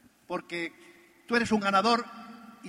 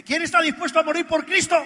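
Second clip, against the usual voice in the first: high, loud bellowing challenge; gunman's shout; 7 semitones higher, 8 dB louder than usual. Retold or imitated. Gunman to the churchgoers, a loud bellowing challenge. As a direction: roar it at the packed church like a gunslinger.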